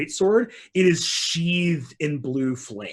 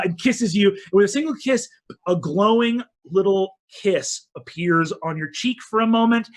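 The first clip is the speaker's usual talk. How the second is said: usual speaking voice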